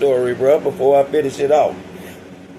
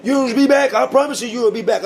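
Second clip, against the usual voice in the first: much higher, taunting singsong; mocking guard; 9 semitones higher, 3 dB louder than usual. Guard mocking the leaving inmate, high and taunting. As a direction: needle him, repeating it higher each time. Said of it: "He talking about".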